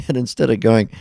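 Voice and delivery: ordinary talk, nothing marked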